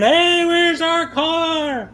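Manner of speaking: very high, slow, stretched panicked yell; husband yelling for the car, very high; retold screaming. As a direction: howl it into the rain in panic. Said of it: "I'm screaming".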